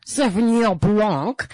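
put-on slow, showy French accent on the wine. Diner showing off while ordering, imitated French accent. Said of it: "so how the accent changes".

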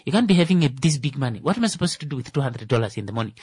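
put-on a taxi driver's Namibian English accent. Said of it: "I love Namibian English".

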